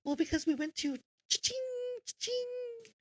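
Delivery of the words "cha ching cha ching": high, ringing cha-ching; sing-song money jingle; cash register cha-ching. Retold or imitated. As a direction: imitate a slot machine paying out.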